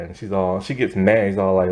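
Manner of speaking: normal talking voice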